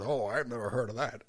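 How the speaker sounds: lightly voiced DEA agent, deadpan denial with a laugh breaking through; his voice. Host imitating the agent denying CIA cocaine, deadpan with a slip of laughter. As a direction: play it innocent, straight-faced but giggling. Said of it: "Okay, in his voice".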